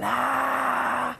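long, high "aah" mimicking a roaring crowd; imitated crowd cheer. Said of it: "cómo se empieza a levantar y gritan". (imitando el grito del público)

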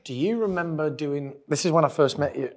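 usual speaking voice

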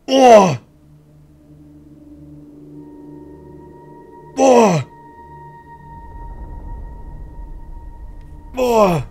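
loud, high stunned gasps; reeling from the fright; shocked exclamation. Real, not acted. Aftershock of jumpscare.

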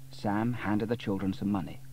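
ordinary delivery

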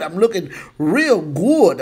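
normal talking voice

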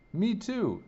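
high, eager little reply. A narrator's reading. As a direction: chirp it like a keen kid.

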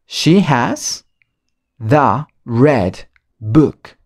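very slow, careful the red book; slow model. Shown as a demonstration. Teacher repeating the sentence slowly for students. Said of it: "Otra vez".

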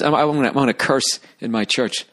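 usual speaking voice

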